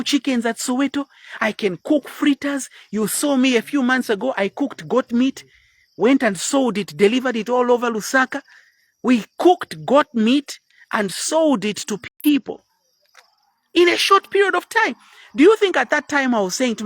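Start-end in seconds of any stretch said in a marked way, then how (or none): none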